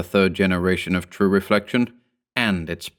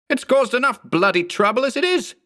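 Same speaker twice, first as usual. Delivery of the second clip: higher, exasperated outburst; angry complaint. A narrator's reading. Mark scolds the clone, high and exasperated. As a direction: vent it high and hard. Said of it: "Mark barked".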